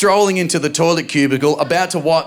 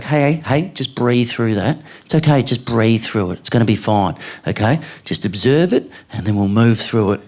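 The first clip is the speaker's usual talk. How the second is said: calm soothing partner; 5 semitones lower than usual. Retold or imitated. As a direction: talk the kid down gently, like a mindfulness coach. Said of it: "That's really good parenting".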